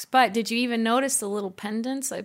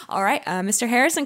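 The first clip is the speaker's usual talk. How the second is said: ordinary talk, nothing marked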